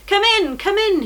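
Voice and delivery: high, loud eager welcome; cried out. A narrator's reading. Innkeeper welcoming pilgrims, loud and high. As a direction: call them in gladly from the door. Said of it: "he cried".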